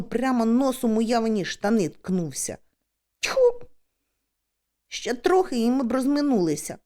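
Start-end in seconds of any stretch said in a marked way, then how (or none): 3.2-3.6 high, sharp 'tfu'; sudden mock spitting noise; disgusted spit. A narrator's reading. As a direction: spit out in annoyance. Bumps into friend, spits.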